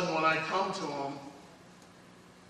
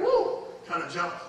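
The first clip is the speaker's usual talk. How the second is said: usual speaking voice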